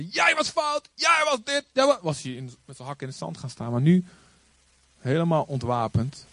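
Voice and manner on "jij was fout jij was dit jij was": high, pointed accusing voice; mock accusation. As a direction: jab the blame at him. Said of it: "als ik er tegenin was gegaan".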